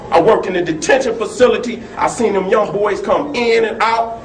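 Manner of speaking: normal talking voice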